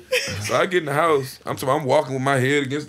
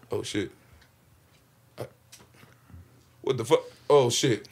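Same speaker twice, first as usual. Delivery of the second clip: dazed dread; 6 semitones lower, 7 dB quieter than usual. Retold or imitated. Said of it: "I'm like".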